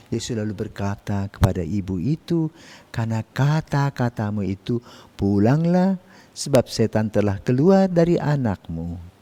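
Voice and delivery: loud, solemn proclamation; gospel reading. Acted. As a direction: read scripture aloud like from the pulpit.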